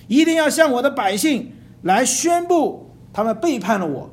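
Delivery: loud, high proclamation like a blown trumpet. Shown as a demonstration. Preacher shows the congregation how to proclaim: trumpet-loud. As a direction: sound it out like a war horn. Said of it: "就是要像吹号一样的去讲".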